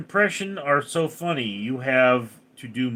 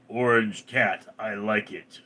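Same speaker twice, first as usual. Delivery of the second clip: ordinary talk, nothing marked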